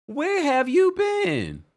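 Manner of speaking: high, slow, sing-song where have you been; drawn-out delighted welcome; excited greeting. Real, not acted. Host welcomes a returning fan from the chat, squealing.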